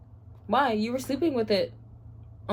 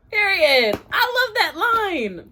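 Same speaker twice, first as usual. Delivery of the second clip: loud, high, emphatic delight; excited cheering; 9 semitones higher, 7 dB louder, slower than usual. Real, not acted. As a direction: shout your love for it. Cheering a favourite line.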